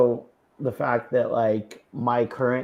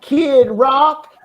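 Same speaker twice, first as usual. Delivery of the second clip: put-on Southern accent; 9 semitones higher, 6 dB louder than usual. Showing off a Southern accent for a friend, hammed-up Southern accent. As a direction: ham up a Southern good ol' boy, laughing. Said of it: "I can do a Southern accent for you".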